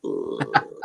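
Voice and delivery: long, low "ooh"; low drawn-out puppet groan of effort; puppet grooming noise. Acted. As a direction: make a beaver's back-scrubbing noise, low. Puppet beaver grooming its back.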